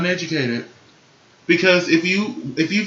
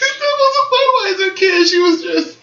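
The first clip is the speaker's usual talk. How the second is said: gushing squeal. Real, not acted. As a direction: squeal it like you adore it. Gushing over a runway look.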